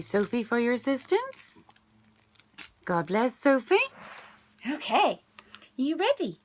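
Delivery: ordinary delivery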